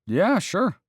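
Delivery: startled by the warden at the window, jumping high. Acted. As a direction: caught off guard, blurt it out high.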